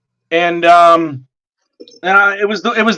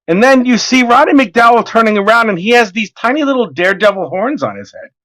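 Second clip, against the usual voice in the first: ordinary talk, nothing marked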